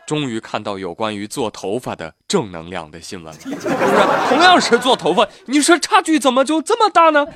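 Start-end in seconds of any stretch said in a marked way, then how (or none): 4.3-7.3 high, loud disbelief; incredulous. Real, not acted. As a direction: marvel at the gap. Amazed comparison.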